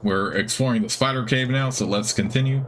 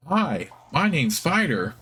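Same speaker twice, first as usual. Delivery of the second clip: slow, higher squeaky creature; squeaky spider; 6 semitones higher, slower than usual. Acted. Guard spider greeting the hero in a squeaky voice. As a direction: squeak it out slowly like a small dim guard. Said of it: "It has a squeaky, high-pitched voice".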